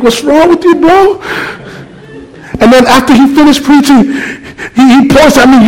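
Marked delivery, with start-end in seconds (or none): 0.0-1.2 high, baffled disbelief at a friend; what is wrong with this guy; incredulous. Retold or imitated. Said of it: "I'm looking at him like".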